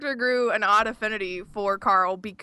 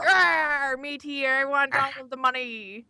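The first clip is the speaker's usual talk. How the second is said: pirate voice; 4 semitones higher, slower than usual. Retold or imitated. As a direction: growl it like a pirate. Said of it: "be like".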